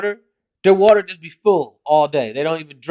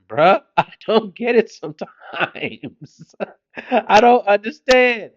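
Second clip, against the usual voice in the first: ordinary talk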